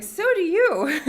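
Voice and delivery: high, loud laughing retort; squealing playful comeback. Retold or imitated. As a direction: fire it back through a big laugh.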